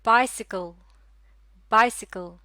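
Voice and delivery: बाइसिकल as one word, loud first beat, falling; careful model pronunciation. Shown as a demonstration. Teacher asking learners to listen carefully, careful model pronunciation. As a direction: say बाइसिकल whole, punching बाइ, then repeat. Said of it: "गौर से सुनिएगा".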